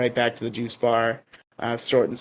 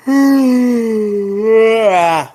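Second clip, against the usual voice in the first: loud, high exasperated groan; a loud, high cry of exasperation; 9 semitones higher, 9 dB louder than usual. Real, not acted. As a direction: let out a loud fed-up groan. Frustrated at cohost.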